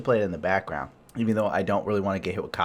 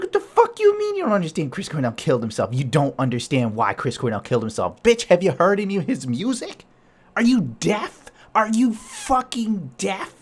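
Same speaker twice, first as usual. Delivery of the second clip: higher, loud exasperated rant; incredulous rant; 9 semitones higher, 5 dB louder than usual. Retold or imitated. Ranting at his girlfriend over [name], loud and incredulous. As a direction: tear into her for not getting it. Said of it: "I'm like".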